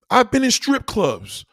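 high, loud and slow; loud slow confession. Acted. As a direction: drag out the yelled confession.

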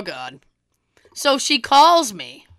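ordinary talk, nothing marked

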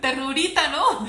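high, loud teasing coo; playful, exaggerated aww; mock sympathy. Real, not acted. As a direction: poke fun at the self-pity. Mocking the whining she imitated.

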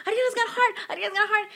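high, loud joyful shout; excited shouting. Retold or imitated. Mother waking the family, shouting the news high and loud. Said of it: "I'm like, screaming".

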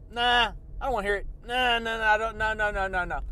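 rapid-fire dismissive nah-nah-nah; mock refusal. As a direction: block it out with a run of no's. Acts out people refusing to listen.

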